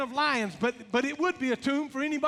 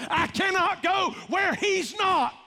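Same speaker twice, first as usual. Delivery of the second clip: high, loud, slow declaration; preaching shout; 7 semitones higher, 4 dB louder, slower than usual. Real, not acted. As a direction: proclaim it with full force. Climax of preaching.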